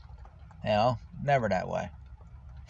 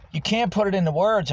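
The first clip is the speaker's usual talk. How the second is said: emphatic retort; 9 semitones higher, 5 dB louder than usual. Retold or imitated. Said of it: "I says".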